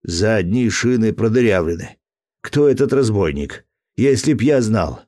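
furious yell as the tyres are shot out; angry growl. Acted. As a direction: rage at the unseen gunman, shouting. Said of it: "Рассеньи зарычал".

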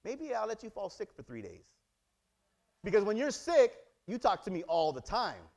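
usual speaking voice

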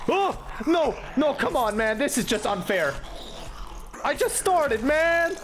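high-pitched, whining outcry; real gamer panic, voice shooting up; distressed protest. Real, not acted. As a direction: wail that it's so unfair. Ambushed right after starting the game.

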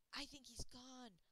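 ordinary delivery

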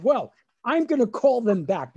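mock a mother taking charge; determined mother. Mother overruling her son's decision, determined. Retelling mother's defiant reply.

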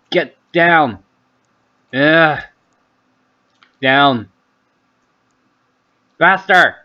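urgent, strained self-urging; straining urgency. Real, not acted. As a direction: push yourself to swallow. Urging himself while swallowing.

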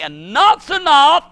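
slow, booming decree; God's decree. Acted. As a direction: declare it with finality. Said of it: "he's gonna say".

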